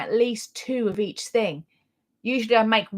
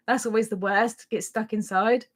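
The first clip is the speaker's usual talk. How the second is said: normal talking voice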